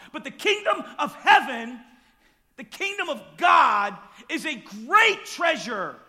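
impassioned sermon, building to shouts; preaching. Acted. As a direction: preach it with fire. Preaching at full force.

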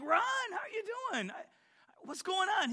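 high surprised greeting; delighted to hear an old friend. Retold or imitated. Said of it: "And I'm like".

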